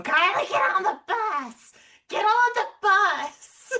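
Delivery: high, loud, drawn-out mom yell; mock yell. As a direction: holler it up the stairs like a fed-up mom. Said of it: "I can hear you going".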